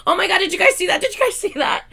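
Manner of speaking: high, loud excited freakout; freaking out. Retold or imitated. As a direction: squeal it at the chat, thrilled. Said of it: "I freaked out".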